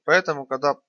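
plain reading voice

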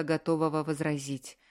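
ordinary delivery

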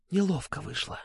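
hushed, embarrassed aside; whisper. A narrator's reading. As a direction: whisper it softly to your companion. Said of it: "шепнула Настя". (смущённым шёпотом)

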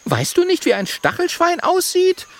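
piping, very high offended question; high-pitched indignant critter. A narrator's reading. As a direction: scold in a tiny high voice. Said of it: "sagt Mathilda empört".

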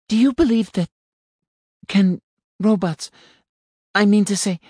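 high, hesitant, breaking off; faltering. A narrator's reading. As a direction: stumble over the words, high. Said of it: "she faltered".

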